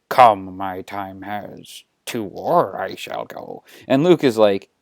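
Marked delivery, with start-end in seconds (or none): 0.1-3.6 slow, low creature voice; solemn old master, drawn out and lowered; Yoda. Acted. Yoda agrees to go to war with Luke, slow and solemn. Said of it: "And Yoda is like".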